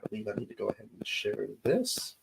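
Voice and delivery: usual speaking voice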